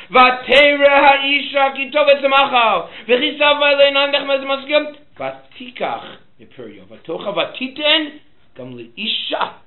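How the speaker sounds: high, loud sustained recital; forceful verse recitation. Real, not acted. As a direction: proclaim the verse at full voice.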